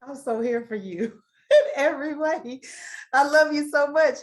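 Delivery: laughing through tears, loud; moved and overjoyed; tearful laughing. Real, not acted. The host answering the testimony, laughing through tears.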